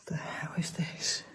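slow, hushed, shaken; frightened whisper. Real, not acted. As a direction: whisper it as the fear sets in. Hears noise alone at night.

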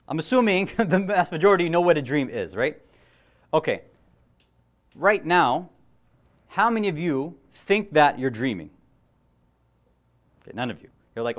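usual speaking voice

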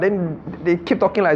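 ordinary talk, nothing marked

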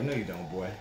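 low, soft scolding inner voice; a hint of a scolding elder; inner voice retort. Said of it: "my voice wouldn't even let me say that".

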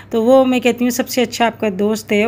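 ordinary talk

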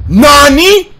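high, loud shocked cry; shocked shout. Real, not acted. Streamer shocked by the game character's outburst, shouting. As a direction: yelp it out in disbelief.

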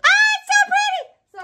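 high, loud excited squeal. Real, not acted. Vlogger seeing the pretty item, excited squeal. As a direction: shriek with delight at how pretty it is. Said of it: "I got excited".

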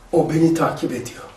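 low, hushed paranoid voice; paranoid murmur. Retold or imitated. Mustafa's hushed paranoid claim about being followed. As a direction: half-whisper that he's being followed. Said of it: "Mustafa öyle diyor".

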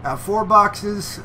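put-on drawn-out Texas accent repeat; fake Texas accent. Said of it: "I'll do a fake Texas accent for this one".

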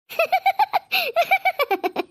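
a bright real laugh breaking out; high burst of laughter. Real, not acted. Daughter laughing at mother's joke, high burst of laughter. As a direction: let the laugh ring out and fall away. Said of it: "ம்ம், சொல்லிடுச்சு அது வேற".

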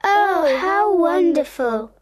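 high-pitched, joyful exclamation; delighted lost boys. Acted. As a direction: exclaim like thrilled children. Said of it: "the lost boys say".

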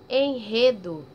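"enredo" with strong prolonged rr. Shown as a demonstration. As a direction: linger on the R of "enredo". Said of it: "um som forte, prolongado". ("enredo" com R forte e prolongado)

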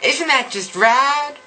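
put-on high bubbly valley girl voice, every totally gushed; mock valley girl accent. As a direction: play a ditzy valley girl gushing to her friends. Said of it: "I really can't do this accent".

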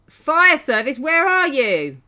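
loud, high, drawn-out call. Real, not acted. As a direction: holler for help that hasn't come. Calling for game's fire service.

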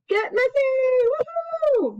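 high, drawn-out gleeful cry; excited cheer. Acted. Teacher cheers to kids about getting messy, high and excited. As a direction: squeal it like messy play is the best.

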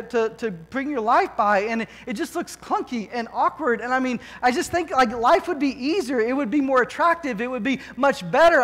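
normal talking voice

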